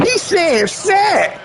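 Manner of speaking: very high, dragged-out mimicry; squealed, drawn-out parody of a boy; mocking a boy. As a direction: mimic him slowly in a high voice. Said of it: "That boy said".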